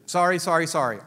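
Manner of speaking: ordinary delivery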